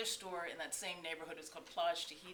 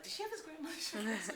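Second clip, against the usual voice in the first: puzzled onlooker; 10 semitones higher than usual. Retold or imitated. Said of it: "Everybody would look like".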